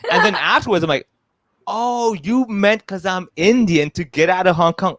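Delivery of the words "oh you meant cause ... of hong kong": slow, stretched-out mock realisation, starting high; belated realisation. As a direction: let the penny drop slowly, hammed up for laughs.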